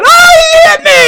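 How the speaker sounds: high, loud, slow mock wail while laughing; mock scream. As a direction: ham up her crying for help. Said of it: "screaming at".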